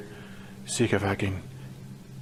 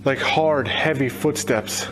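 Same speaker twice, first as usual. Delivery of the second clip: ordinary delivery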